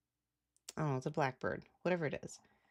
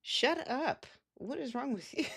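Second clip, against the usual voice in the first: much higher, sharp shout at the dogs; exasperated yell; 10 semitones higher than usual. Retold or imitated. To her dogs whining for food, an exasperated higher shout. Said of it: "And I'm like".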